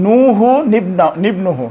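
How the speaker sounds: নুহু নিবনুহু said very slowly with the small নুন as নি; slow recitation. Shown as a demonstration. Teacher showing the small নুন in the Quran, slowly. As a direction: draw out নুহু নিবনুহু deliberately. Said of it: "ছোট নুন এইভাবে আছে".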